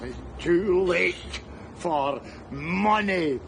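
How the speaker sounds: ordinary delivery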